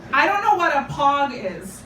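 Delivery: ordinary delivery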